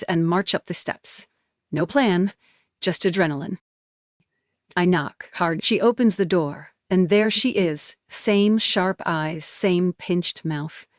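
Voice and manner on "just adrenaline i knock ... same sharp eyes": ordinary delivery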